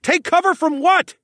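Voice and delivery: high, loud, bewildered yell. A narrator's reading. Alex demanding to know the danger, yelling. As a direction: yell the question back urgently. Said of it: "I yelled".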